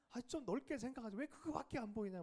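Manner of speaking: exasperated inner thought. Retold or imitated. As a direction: vent at the crying brother's narrow view.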